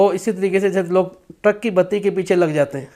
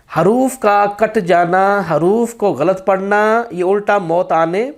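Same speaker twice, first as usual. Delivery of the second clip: normal talking voice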